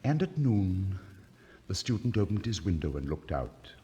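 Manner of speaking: plain reading voice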